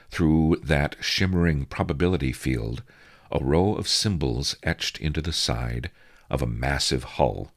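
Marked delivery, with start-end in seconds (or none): none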